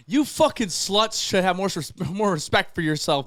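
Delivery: loud, high imitation of an outraged mother; mom's furious scolding. Mom catching strip pong in her house, furious scolding. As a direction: tear into them as the angry mom.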